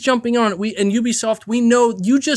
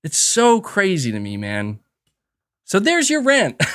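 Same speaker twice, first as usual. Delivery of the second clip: ordinary talk, nothing marked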